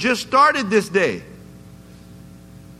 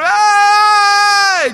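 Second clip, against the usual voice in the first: high, loud shout from afar; calling out; 11 semitones higher, 8 dB louder than usual. Retold or imitated. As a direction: yell out as you run up. Islander yelling while running.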